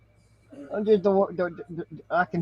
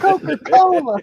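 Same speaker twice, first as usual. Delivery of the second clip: high, loud, through laughter; laughing protest; 13 semitones higher, 8 dB louder than usual. Real, not acted. Clearing up what Coke meant after a drug joke, laughing protest. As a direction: shout it out while cracking up. Clarifying the drink, amid laughter.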